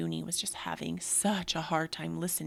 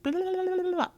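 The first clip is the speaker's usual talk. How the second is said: high mocking blah blah; squeaky, louder mock chatter; 12 semitones higher, 5 dB louder, faster than usual. Mocking her own mind's chatter in a high voice.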